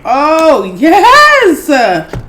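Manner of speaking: loud, high, drawn-out cheer; delighted exclamation. Real, not acted. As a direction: let the "yes" soar with delight. Delighted at juicy steamed crab.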